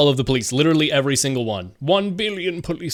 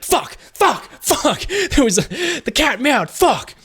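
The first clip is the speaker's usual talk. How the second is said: mock villain panic; 8 semitones higher, slower than usual. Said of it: "he was like".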